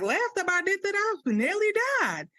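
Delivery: high, loud overdramatic wail; dramatic exaggeration. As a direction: exaggerate it wildly.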